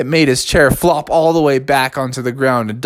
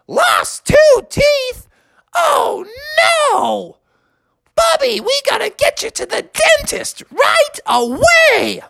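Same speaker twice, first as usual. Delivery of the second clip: very high, shrieking alarmed mother; panicked mom. Acted.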